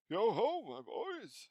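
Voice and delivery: put-on loud, hearty, booming old master; jovial rich voice. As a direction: call out fat and jolly. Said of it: "jovial voice".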